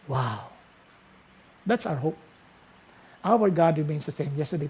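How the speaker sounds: ordinary talk, nothing marked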